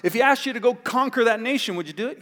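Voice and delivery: calming servant. Retold or imitated. Servant calming the furious general.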